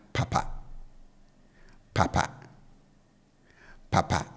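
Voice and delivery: "papa" with both syllables kept low. Shown as a demonstration. Teacher showing learners the second Twi word, low-low tone on "papa". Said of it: "I bring the tone on both syllables down".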